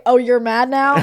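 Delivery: lightly voiced snarky taunt; mocking quote. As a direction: parrot the line back with a mild sneer. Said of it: "I did not say".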